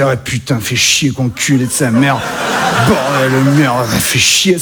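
put-on Parisian accent, low, grumbling; mock Parisian swearing, low; accent parisien. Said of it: "je peux essayer d'imiter l'accent français". (accent parisien grave et râleur)

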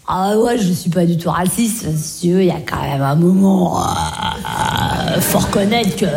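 low, mumbling hesitant excuse-maker. Acted. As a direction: hem and haw with drawn-out ers. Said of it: "toutes ces phrases qui commencent par".

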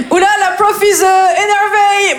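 high, drawn-out pupil voice; mock the teacher in a high, slow franglais; élève moqueur. [name] se moque de la prof. (voix aiguë d'élève qui se moque)

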